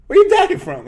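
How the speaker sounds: high, loud mock coach; hammed-up coach probing his family; coach asking. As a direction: fire the question off bluntly. Coach questioning him.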